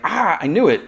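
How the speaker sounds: high, loud mock exclamation; triumphant cry. Lois to unmasked Toyman, triumphant. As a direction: pounce on it, imitating her triumph.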